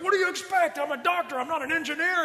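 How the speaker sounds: high, exasperated doctor's rant; Bones yelling. Retold or imitated. Bones yelling at Captain Kirk, high and exasperated. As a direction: rant like a fed-up ship's doctor. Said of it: "And he's yelling".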